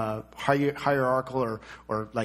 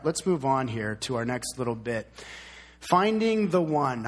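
usual speaking voice

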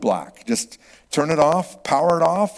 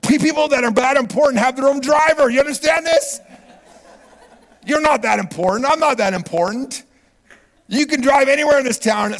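loud, high-pitched rant; worked-up, high shouted lecture; exasperated scolding; 12 semitones higher, 7 dB louder than usual. Acted. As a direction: scold them at full preaching volume. Mock scolding of texting drivers.

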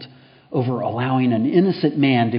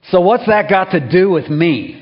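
louder, higher blunt American retort; 6 semitones higher, 8 dB louder than usual. Retold or imitated. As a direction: toss it off like a brash American. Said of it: "if he had been an American".

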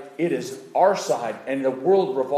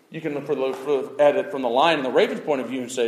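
ordinary talk, nothing marked

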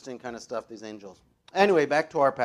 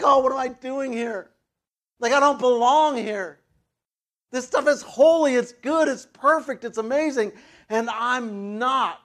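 higher and louder, an awed voice full of dismay; overwhelmed Isaiah; 9 semitones higher, 8 dB louder than usual. Retold or imitated. Preacher voicing Isaiah before God's throne, high and awed. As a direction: cry out that you don't belong here, overwhelmed.

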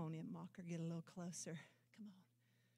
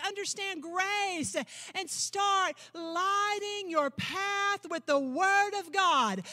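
loud, ringing pulpit proclamation; swelling revival preacher cry; preaching at full force; 8 semitones higher, 10 dB louder than usual. Acted. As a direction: preach it out to the whole hall.

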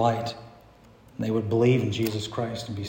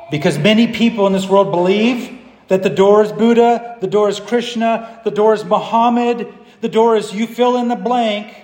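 higher, louder pulpit listing; forceful preaching; 9 semitones higher, 7 dB louder than usual. Real, not acted. Preacher lists what many in the world believe, raised and forceful. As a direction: pile up the rival claims insistently.

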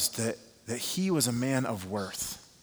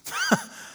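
short laugh. Real, not acted. Laughs at own story.

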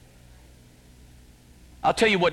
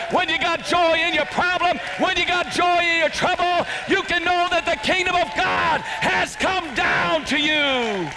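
full-force preaching; 10 semitones higher than usual. Acted.

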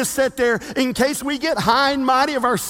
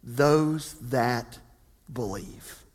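hushed conclusion; 13 semitones lower, 7 dB quieter, much slower than usual. Real, not acted. Soft closing.

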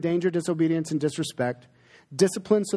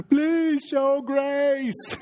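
put-on high, slow, drawn-out child's plea; kids begging; 10 semitones higher, much slower than usual. Children pleading with dad for grace, high drawn-out whine. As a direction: whine it like a child angling for mercy. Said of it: "And so every time".

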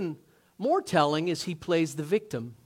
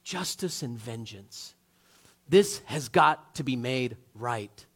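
voice of blood; 5 semitones lower, slower than usual. Retold or imitated. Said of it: "It's saying".

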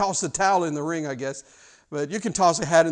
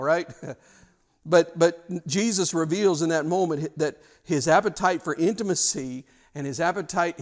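ordinary delivery

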